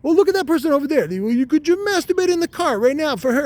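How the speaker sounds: a pushy squeak egging him on; talking testicle. Acted. A high-voiced testicle urging the boss in the car. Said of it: "And he's like".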